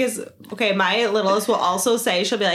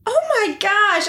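retold shock; 9 semitones higher than usual. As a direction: exclaim it high, re-enacting the shock. Retold shock at single Kit Kat.

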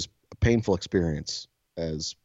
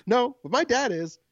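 put-on high, proud little kid. Kid answering the cop question, high childlike voice. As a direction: say it with a child's pride.

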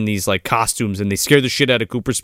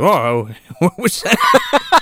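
startled mock wake-up; 6 semitones higher, much slower than usual. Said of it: "He's like".

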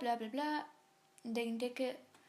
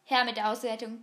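eager demand; 7 dB louder than usual. Shown as a demonstration. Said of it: "repeats the same demand".

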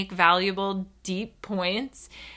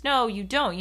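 usual speaking voice

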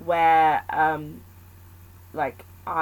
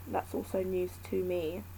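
usual speaking voice

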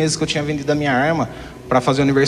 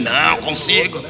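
imitação leve de bêbado insistente, arrastando as palavras; mock drunk insistence, slightly dragged out; bêbado teimoso; 3 semitones higher, slower than usual. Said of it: "tá caindo de bêbado". (bêbado teimoso querendo dirigir)

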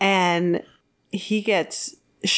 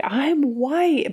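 high-pitched mock yell; half-projected retold yell; 8 semitones higher than usual. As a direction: imitate a desperate shout, voice lifted high. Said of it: "yelling that he's like".

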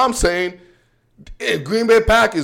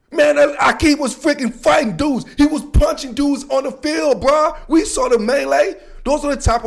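fired up; 7 semitones higher than usual. Real, not acted. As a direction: shout it like you can't believe it. Hyped up recalling a fight.

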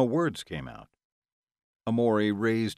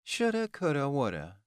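high singsong mockery, dropping at the end; mock her regrets, starting high; sarcastic; 6 semitones higher than usual. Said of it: "Delilah replied sarcastically".

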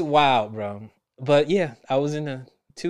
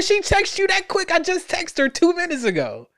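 incredulous reaction; 4 dB louder than usual. Retold or imitated. Shocked reply to sister-in-law.

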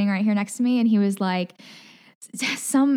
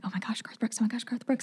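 whispering; 11 dB quieter than usual. Retold or imitated.

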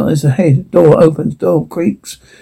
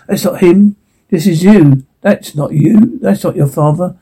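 ordinary talk, nothing marked

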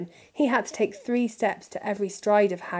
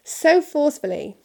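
usual speaking voice

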